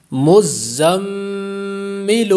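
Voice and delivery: مزمل recited very slowly with a held nasal ghunna on the doubled meem; tajweed recitation. Shown as a demonstration. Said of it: "زا کو سختی سے پڑھیں گے".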